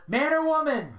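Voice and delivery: high, eager blurt; a blurted man or woman; quick blurted question. Retold or imitated. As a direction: fire it right back. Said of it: "his immediate answer was".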